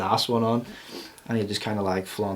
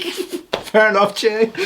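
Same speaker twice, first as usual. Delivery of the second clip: mock indulgent; 11 semitones higher, 4 dB louder, slower than usual. Mock reply to Facebook post.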